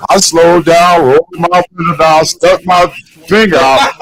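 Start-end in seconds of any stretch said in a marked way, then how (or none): none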